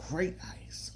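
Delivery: breathy whisper of delight. Real, not acted. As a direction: whisper it like a secret treat. Praising hospital ice.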